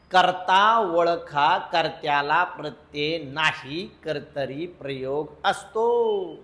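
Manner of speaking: drawn-out rule, phrase by phrase; slow, measured recital. Shown as a demonstration. Teacher repeating the rule for the class, slow and deliberate. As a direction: spell the rule out slowly with spaces between phrases.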